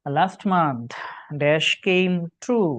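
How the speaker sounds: reading the question out very slowly; each word drawn out for the class; slow exam-question read. Shown as a demonstration. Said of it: "দেখো question number nine". (পরীক্ষার প্রশ্ন খুব ধীরে পড়া)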